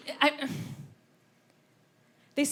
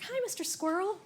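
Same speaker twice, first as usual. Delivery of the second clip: sweet greeting; 8 semitones higher, 4 dB quieter than usual. Retold or imitated. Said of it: "You're like".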